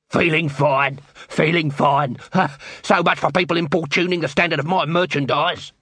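put-on voice insisting he's well; queasy Dibbler. Dibbler, green in the face, defending his merchandise, queasy. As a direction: cover sickness with protest.

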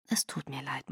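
very soft, low murmur; murmured apology. Acted. Edward to overheated Bella on waking, murmured apology. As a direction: murmur it under the breath, almost whispered. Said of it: "murmelte Edward".

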